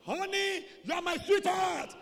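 high, blaring endearment; problem shout. Acted. Lover with a problem, shouting to honey. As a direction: holler it across a distance. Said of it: "There's a problem".

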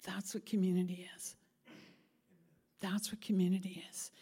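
hushed emphasis. Real, not acted. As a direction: let it sink in quietly. Sermon point, hushed repeat.

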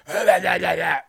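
real chuckle breaking into the sentence; laugh. Real, not acted. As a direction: laugh at yourself mid-story. Laughs mid-story about own voice.

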